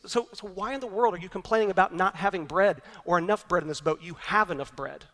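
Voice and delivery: normal talking voice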